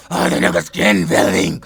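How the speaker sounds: loud, low-pitched bellow of disbelief; horrified outburst. Real, not acted. Reacting to the child's skin peeling, horrified bellow. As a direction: blurt it out loudly in horror.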